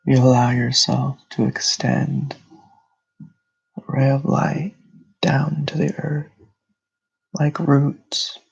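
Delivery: unhurried meditation voice with long pauses; guided meditation. Real, not acted. Host leading listeners through breathing and grounding, meditative delivery. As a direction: guide them into calm, phrase by phrase.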